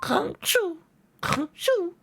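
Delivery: high cartoon honk-shoo snore; snoring. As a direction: fake sleepy snoring. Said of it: "like a real little sleepy boy".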